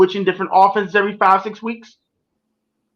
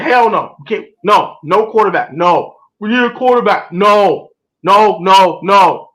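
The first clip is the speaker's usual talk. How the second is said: loud string of hard noes; emphatic refusal; 4 dB louder, slower than usual. Retold or imitated. Fan retelling his draft night protest, emphatic refusal. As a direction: reenact a refusal, louder on each no.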